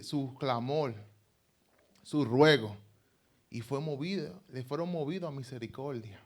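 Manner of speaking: ordinary talk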